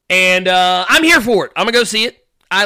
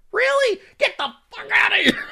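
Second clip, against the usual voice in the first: very high squealed protest; high-pitched comic outburst; exasperated mock outrage. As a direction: squeal it in hammed-up disbelief. Mock outrage at online complaints.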